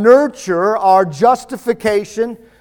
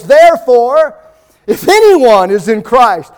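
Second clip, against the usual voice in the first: Titus read higher and louder, building; fervent preaching; 3 semitones higher, 4 dB louder than usual. Acted. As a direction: proclaim the verse like a sermon climax. Preaching scripture at full force.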